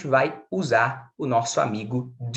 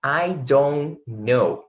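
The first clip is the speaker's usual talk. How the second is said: slow demo; much slower than usual. Shown as a demonstration. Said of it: "Olha essas aqui".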